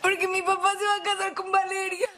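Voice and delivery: sobbing out the news; crying. Acted. Sobbing to Alma about her father's wedding. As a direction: blurt it out while crying.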